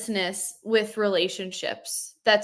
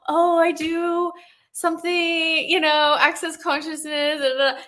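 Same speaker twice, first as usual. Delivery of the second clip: vague mumbling; 7 semitones higher, 3 dB louder than usual. Retold or imitated. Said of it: "I used to be like".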